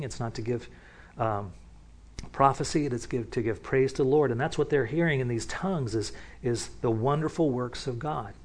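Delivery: ordinary talk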